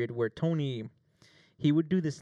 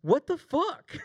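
a much higher, laughing "What the fuck?"; coworker's laughing confusion; 4 dB louder, slower than usual. Retold or imitated. Tony, just slapped on set, laughing in disbelief. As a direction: play him baffled and cracking up. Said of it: "he was like".

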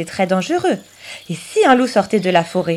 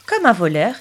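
plain reading voice

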